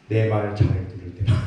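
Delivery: low, firmer deadpan catch; conditional punchline. Retold or imitated. Pastor quoting the ad's ironic condition for a parent's love. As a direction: land the twist dryly. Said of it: "이런 광고가 있어요".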